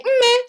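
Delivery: "Mme" called high with an eh vowel at the end. Shown as a demonstration. Daughter calling her mom, "Mme" high and sweet. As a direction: call "Mme" up high, ending in eh. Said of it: "I'd be like".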